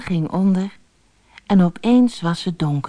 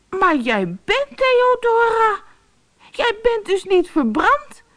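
put-on a boy calls out his delight, much higher; happy cry. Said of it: "riep Brammetje Braam blij". (blij roepende hoge kinderstem)

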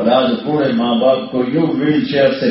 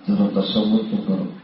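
ordinary talk

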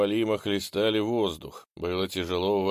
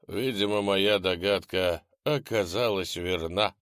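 ordinary delivery, nothing marked

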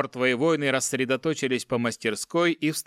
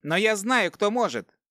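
hopeful dwarf; 8 semitones higher than usual. A narrator's reading. Dwarf has a sudden idea.

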